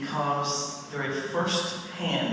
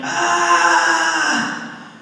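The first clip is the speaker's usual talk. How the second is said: high, louder sung-out scream; ironic calm scream; 14 semitones higher, 7 dB louder than usual. Retold or imitated. Seeing a ghost rising from the stereo, mock scream. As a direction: let out a hammed-up terrified scream. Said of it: "very calmly I screamed".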